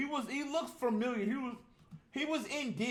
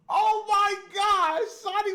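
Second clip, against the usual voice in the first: shocked gasp; 12 semitones higher, 7 dB louder, slower than usual. Real, not acted. Gasping at twist.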